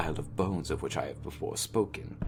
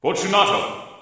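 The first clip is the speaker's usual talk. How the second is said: loud higher call into the niche; called aloud; 12 semitones higher, 9 dB louder than usual. Acted. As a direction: call his name into the dark. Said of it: "I called aloud".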